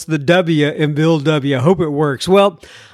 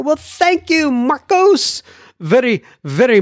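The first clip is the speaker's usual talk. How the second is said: much higher, beaming thanks; grateful; 8 semitones higher than usual. Real, not acted. Host thanking a Spanish-speaking listener, beaming. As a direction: lift it up in delighted gratitude. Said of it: "grateful thanks".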